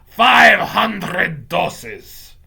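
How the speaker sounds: very loud, drawn-out witch yell; villainous witch's triumphant yell; Grand High Witch yelling. Acted. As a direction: bellow slowly in a high, accented witch voice. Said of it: "the voice of the Grand High Witch yelling".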